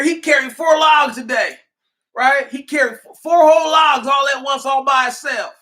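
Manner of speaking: hammed-up master showing off his man's strength; mock slave owner bragging. Master bragging to a friend about Jimbo, high mock shout.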